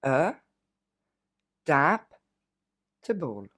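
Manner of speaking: E, dap, table with pauses between parts; split into syllables. Shown as a demonstration. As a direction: chop adaptable into three pieces. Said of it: "Encore une fois". (découpé en trois syllabes)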